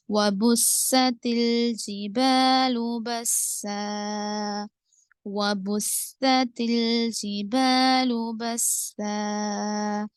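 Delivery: slow, drawn-out tajweed chant; Quranic recitation. Shown as a demonstration. Tajweed teacher reciting for students, slow measured chant. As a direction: recite the verse slowly with elongated vowels.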